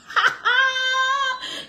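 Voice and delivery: shrill high laugh breaking out; real laugh cracking up; high burst of laughter. Real, not acted. Bursts out laughing mid-rant.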